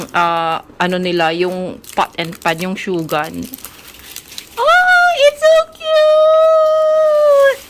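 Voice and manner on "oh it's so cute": very high, loud, slow gushing squeal; over-the-top squeal. Acted. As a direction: gush like a starstruck fan. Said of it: "Ang arte-arte ko naman".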